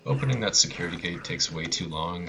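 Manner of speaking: ordinary talk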